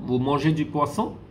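"Vous mangez du poisson" climbing on "poisson"; rising question tune on "Vous mangez du poisson"; questioning intonation. Shown as a demonstration. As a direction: ask it purely by raising the end of "poisson". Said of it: "It is actually in intonation form".

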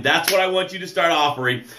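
normal talking voice

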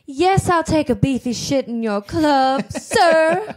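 hammed-up old Hollywood transatlantic accent. Shown as a demonstration. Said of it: "transatlantic accent".

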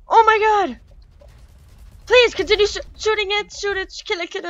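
high, loud panicked pleading. Real, not acted. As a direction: beg in a rush of fear. Panicked pleading during attack.

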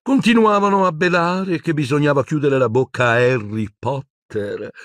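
high put-on voice, slowing to menace; self-righteous villainess. Umbridge rants, threatens Potter.

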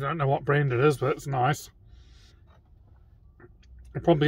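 speaking between bites; talking while chewing. Real, not acted. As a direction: mouth partly full of food. Seen 0: Bites at -3, then mouth works while burger held throughout.

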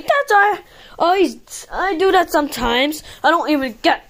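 normal talking voice

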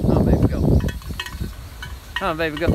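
loud coaxing call; urging call. Real, not acted. Loudly urging the baby goat to go.

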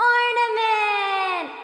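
slow, loud OR-na-ment called out; slow chanted shout of Ornament; syllable shout. Shown as a demonstration. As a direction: call the word out slowly, syllable by syllable. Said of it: "Let's clap it".

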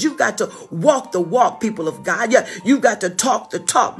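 ordinary delivery

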